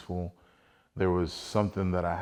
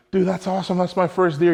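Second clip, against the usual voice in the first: higher and louder, bursting with joy; thrilled; 10 semitones higher, 7 dB louder than usual. Retold or imitated. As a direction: shout it like you just won. Celebrating his first deer.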